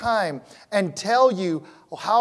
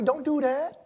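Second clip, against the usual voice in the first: ordinary delivery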